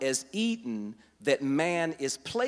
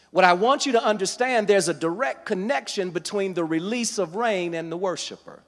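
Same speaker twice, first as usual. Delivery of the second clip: raised, louder preacher's voice; full-force preaching; 5 semitones higher, 6 dB louder than usual. Acted. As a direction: build to a loud, high-pitched preaching peak. Preaches to a responding congregation.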